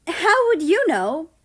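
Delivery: high, loud shot back; excited retort. A narrator's reading. Japan shooting back at America, high and excited. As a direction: fire it back eagerly. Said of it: "the excited country shot back".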